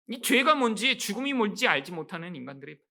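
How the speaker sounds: ordinary delivery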